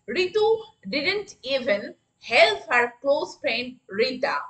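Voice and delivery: very slow, word by word; slow classroom dictation. Shown as a demonstration. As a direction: spell it out slowly for the class. Teacher models a sentence.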